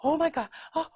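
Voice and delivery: frantic repeated pleading, acted out; panicking woman. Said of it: "So the woman's like".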